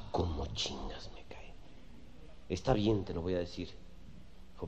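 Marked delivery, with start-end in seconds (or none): 0.1-1.5 annoyed whisper, slow; whispered aside. Acted. Fed-up boyfriend whispering his annoyance to himself. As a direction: mutter it under your breath, fed up.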